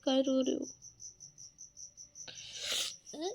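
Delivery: gentle coaxing. Real, not acted. Wife softly coaxing her crying husband to look at her. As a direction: coax him softly to stop crying.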